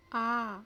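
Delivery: long आ with the mouth wide open; open-mouthed vowel. Shown as a demonstration. Teacher showing a fully open vowel, wide and held. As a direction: open right up and hold आ. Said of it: "मुख खुलकर वृत्त का रूप ले ले".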